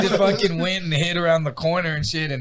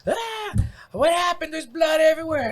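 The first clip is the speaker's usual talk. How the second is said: mom screaming; 13 semitones higher than usual. Retold or imitated. Mom finding dad bloody at the door, screaming in panic. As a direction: shriek it in a panic. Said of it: "just started screaming".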